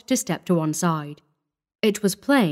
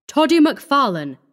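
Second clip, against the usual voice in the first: higher, louder herald-like hail; loud, insistent call; 7 semitones higher, 5 dB louder than usual. A narrator's reading. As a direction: call the name out boldly. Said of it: "he said somewhat loudly".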